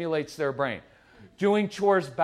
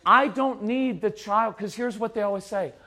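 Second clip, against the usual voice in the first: ordinary talk, nothing marked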